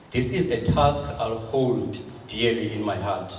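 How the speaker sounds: normal talking voice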